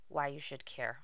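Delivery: normal talking voice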